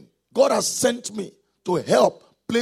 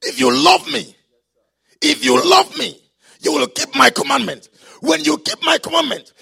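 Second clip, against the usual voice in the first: loud, insistent preacher proclaiming a promise; full-force preaching; 3 semitones higher, 8 dB louder than usual. Real, not acted. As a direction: drive each promise home loudly to the congregation.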